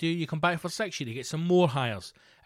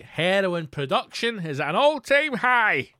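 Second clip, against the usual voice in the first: loud, high, drawn-out proclamation; showman's rising, booming repeat; triumphant announcement; 6 semitones higher, 6 dB louder, slower than usual. Acted. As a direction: proclaim it like glorious news to a crowd.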